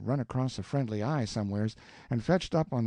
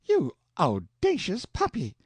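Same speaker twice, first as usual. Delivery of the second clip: higher, slow scandalized aunt; Aunt Sally scolding the kissing boy, outraged; indignant outburst; 7 semitones higher than usual. A narrator's reading. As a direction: spit out the insult in outrage. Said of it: "jumped up and wiped it off".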